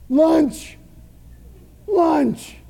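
loud, emphatic outburst of appetite; hungry excitement. Acted. Hungry Peter seeing the sheet from heaven, eager. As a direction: call it out high and loud, relishing it.

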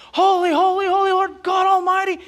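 high, loud angelic acclamation; acted heavenly cry of worship; exalted praise. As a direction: proclaim it like a choir of angels.

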